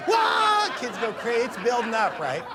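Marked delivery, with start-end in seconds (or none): 0.1-0.7 high, loud mock yell; a wild rallying yell; hype yell. Singer whipping the kids into a frenzy, yelling. Hyping the crowd.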